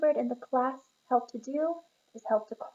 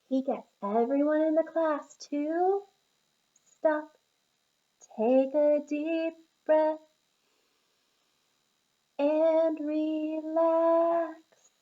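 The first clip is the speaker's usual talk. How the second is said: slow calming guidance; 5 semitones higher, 5 dB louder, much slower than usual. A narrator's reading. Leading star breathing.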